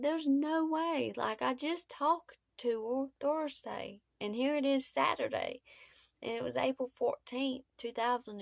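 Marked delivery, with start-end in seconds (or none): 0.0-5.6 refusing to believe the news, voice climbing high; shaky, pitched-up disbelief at terrible news; stunned disbelief. Retold or imitated. Told of friends' deaths.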